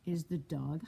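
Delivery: plain reading voice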